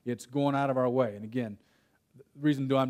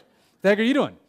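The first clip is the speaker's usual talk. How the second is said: louder, higher alarmed yell; a son yelling back at his dad, louder and higher; retold yell at dad; 5 semitones higher, 6 dB louder than usual. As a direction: shout it back up at him.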